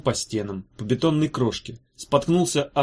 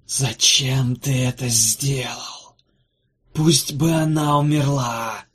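slow, eerie arguing spirit voices; otherworldly quarrel, drawn out; ghostly voices; much slower than usual. Acted. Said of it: "Нестройным хором заспорили шесть голосов".

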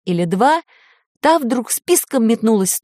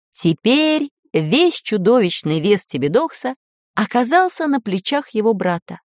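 usual speaking voice

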